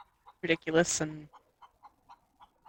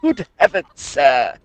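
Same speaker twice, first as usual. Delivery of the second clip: louder posh English exclamation; posh English gent, louder; posh English accent; 2 semitones lower, 5 dB louder than usual. Retold or imitated. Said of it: "English posh voice".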